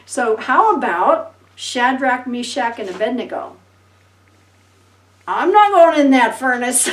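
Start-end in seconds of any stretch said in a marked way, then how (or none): 5.3-6.9 loud, laughing mock refusal. Preacher voicing a scared man refusing the furnace, loud mock fear. Hypothetical refusal, then denied.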